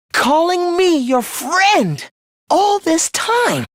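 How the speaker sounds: high, slow, anguished outrage; outraged disgust. Acted. Villain revolted that humans called him friend, outraged. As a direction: pitch it up and stretch it in revulsion.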